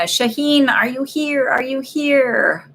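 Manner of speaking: high sing-out call, last word drawn out. Real, not acted. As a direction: call for someone who may not be there. Calling for an absent client.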